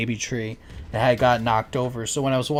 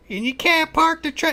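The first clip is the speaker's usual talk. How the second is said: mocking nagging mom; 3 dB louder than usual. Retold mom yelling at son.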